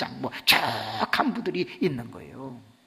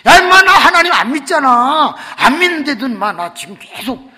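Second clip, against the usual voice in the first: loud, high bragging; boastful friend; 11 semitones higher, 17 dB louder than usual. Retold or imitated. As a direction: crow about not believing. (친구가 크게 높여 자랑하듯)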